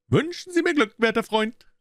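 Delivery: excited shout. A narrator's reading. Gregson bursting in, greeting Holmes triumphantly. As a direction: call out like a man who has won. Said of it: "rief er".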